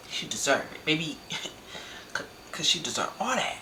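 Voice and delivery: breathy, unvoiced laughing gloat; a quiet laughing gloat over a rival getting beat. Real, not acted. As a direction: giggle through the gloating.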